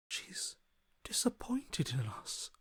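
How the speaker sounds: fading into a slow whisper; a hushed, slow warning. Acted. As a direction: let it sink to a breathless whisper. Whispered grim news.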